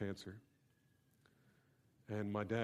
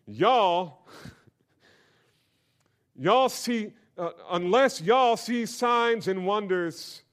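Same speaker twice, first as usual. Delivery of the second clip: loud, high Texas drawl of y'all, laughing; hammed-up Texan y'all with a laugh; playful Texas twang on y'all; 9 semitones higher, 14 dB louder, much slower than usual. Shown as a demonstration. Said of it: "being in Texas, they ought to have a translation just for us".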